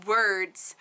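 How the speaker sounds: normal talking voice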